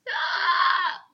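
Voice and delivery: loud high wailing shriek; banshee shriek. Acted. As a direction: let out a ghostly scream.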